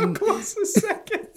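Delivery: very high, slow, through laughter; laughing mimicry. Co-host echoing the dad's question, laughing hard. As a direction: squeeze the question out while laughing.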